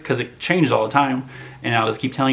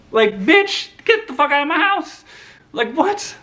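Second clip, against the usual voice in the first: much higher, indignant squeal; mock outrage; 3 dB louder than usual. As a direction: play the offended host in a shrieking high voice.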